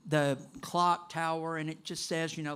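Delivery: ordinary talk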